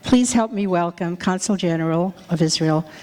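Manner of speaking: normal talking voice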